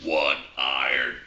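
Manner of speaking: a low, slow little frog calling out the club; talking frog. Acted. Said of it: "and the frog goes".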